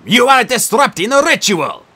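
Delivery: loud, high protest. Acted. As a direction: shout him down to stop the ritual. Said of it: "Nico protested".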